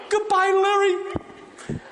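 frightened hostage ending the call; scared goodbye. Retold or imitated. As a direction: drag it out nervously. Scared victim hangs up.

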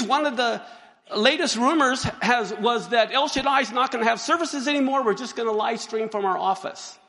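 ordinary delivery

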